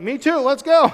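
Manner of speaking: acted-out "count me in" shout; eager rallying cry. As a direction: jump in like an eager volunteer. Acting eager recruit joining Joab.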